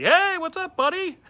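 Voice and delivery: loud, high, hearty fake cheer; booming fake-friendly hello to a regular; fake buddy greeting. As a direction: overdo the friendly bartender hello.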